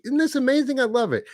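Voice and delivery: high-pitched, thrilled exclamation; genuinely amazed and delighted; excited delight. Real, not acted. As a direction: let the pitch leap with real excitement. Thrilled at worldwide viewers joining live.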